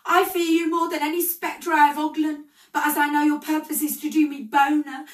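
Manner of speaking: high and loud, pleading with dread; fearful exclamation. A narrator's reading. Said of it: "he exclaimed".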